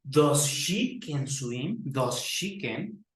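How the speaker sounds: slow, spelled-out error; deliberately slow wrong question; slow wrong example. Shown as a demonstration. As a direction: drag out the mistake. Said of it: "no decimos".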